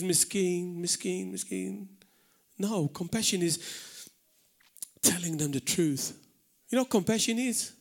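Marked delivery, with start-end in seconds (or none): 0.0-1.9 "miskin" said with fading pity, softer each time; pitying Maltese "miskin". Shown as a demonstration. Teaching the congregation Maltese pity, softening. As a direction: cluck "miskin, miskin" like a sorry neighbour. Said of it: "as we say in Maltese".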